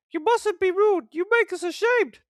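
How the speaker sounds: put-on shocked old ladies twittering; the coaches gossiping about Thomas, high twitter. A narrator's reading. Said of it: "they kept telling Thomas".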